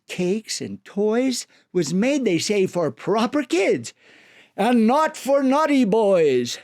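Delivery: usual speaking voice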